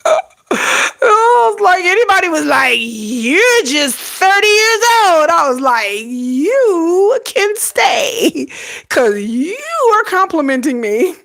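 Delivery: high, slow, near-crying laughter; laughing through it. Retold or imitated. To viewers, laughing so hard it nearly cries. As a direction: squeal it out between laughs.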